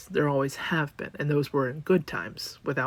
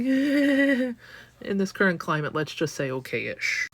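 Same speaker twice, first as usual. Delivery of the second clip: wry reply; 5 semitones higher, 4 dB louder, slower than usual. Retold or imitated.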